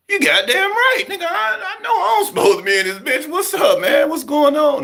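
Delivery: high, laughing and loud; nervous laughing reply. Retold or imitated. Scared new inmate laughing nervously at the huge man. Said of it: "I'm like".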